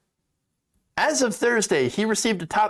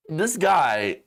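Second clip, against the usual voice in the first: usual speaking voice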